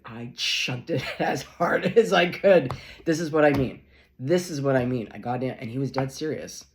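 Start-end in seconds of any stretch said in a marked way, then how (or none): 0.0-2.7 laughing through the punchline, louder; friend's punchline dissolving into laughter. Retold or imitated. Retelling Ash's funny story. 3.0-5.1 emphatic. Real, not acted. Emphatic reaction to story.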